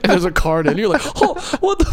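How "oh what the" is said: high-pitched baffled gasp of words; mock astonishment. Stranger finding a card in their watch, high mock shock. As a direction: play the freaked-out bystander, voice shooting up. Retold stunned reaction to trick.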